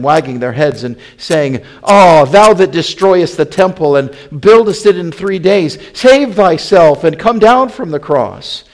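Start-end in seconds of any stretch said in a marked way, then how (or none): 1.8-8.6 loud, high-pitched taunting; railing. A narrator's reading. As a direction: hurl it as jeering passers-by. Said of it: "railed on him".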